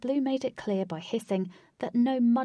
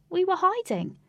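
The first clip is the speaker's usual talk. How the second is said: put-on high girl voice; 12 semitones higher, 5 dB louder than usual. Katie mud-covered, sheepish.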